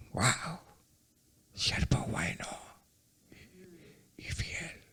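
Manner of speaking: whispered praise from God. Retold or imitated. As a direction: breathe it softly like a blessing.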